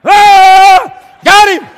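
huge, high roar of victory; triumphant yell. Retold or imitated. Man who just killed the rat, triumphant roar. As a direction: bellow in mock triumph over a tiny foe. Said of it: "Now I felt like".

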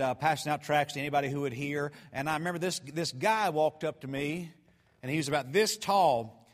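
usual speaking voice

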